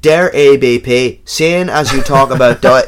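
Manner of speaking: loud, slow, put-on Dublin accent. Shown as a demonstration. Co-host reading a listener's letter on request, Dublin accent. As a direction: read it out in broad Dublin, taking your time. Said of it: "can you do, like, Dublin?".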